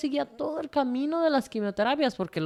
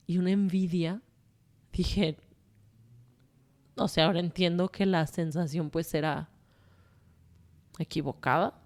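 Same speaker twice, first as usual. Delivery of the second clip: ordinary talk, nothing marked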